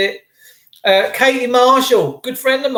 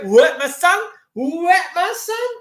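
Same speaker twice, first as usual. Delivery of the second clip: cheery Cockney greeting; 9 semitones higher than usual. Real, not acted.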